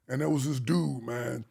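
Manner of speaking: ordinary talk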